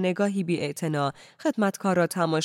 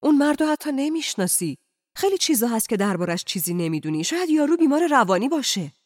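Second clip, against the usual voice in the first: raised, agitated objection; protesting; 7 semitones higher, 4 dB louder, faster than usual. A narrator's reading. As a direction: protest with pitch leaping high. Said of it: "اعتراض کنان گفت".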